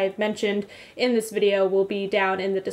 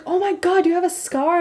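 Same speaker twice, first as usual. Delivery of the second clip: high-pitched excited exclamation; imitated excited yelling; 9 semitones higher, 3 dB louder than usual. Retelling Lee finding out about the scar, imitated excitement. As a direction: squeal it like a thrilled new friend. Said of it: "she started yelling. She was so excited".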